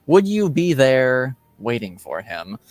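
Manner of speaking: ordinary talk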